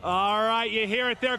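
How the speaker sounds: ordinary talk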